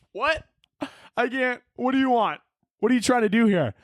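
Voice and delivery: high-pitched mock bafflement; feigned confusion. Driver pretending not to understand another driver's signals, feigned confusion. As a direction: play clueless, pitched right up.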